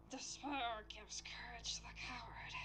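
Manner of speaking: sinister whispered line; creepy whisper. Acted. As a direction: hiss it softly like a villain. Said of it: "I need to creep your voice for him".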